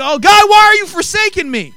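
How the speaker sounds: high, loud mock complaint; griping to God. Believer in trouble griping to God, loud high-pitched protest. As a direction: wail it as an exaggerated gripe at God. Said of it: "We start griping".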